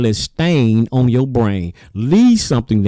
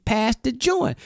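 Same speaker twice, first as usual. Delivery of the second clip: high, softer mock party voice; 11 semitones higher, 5 dB quieter than usual. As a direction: toss it off like a party star.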